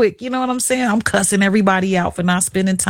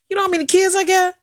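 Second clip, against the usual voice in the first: high fast quizzing; 11 semitones higher, much faster than usual. Retold or imitated. Questioning confused father.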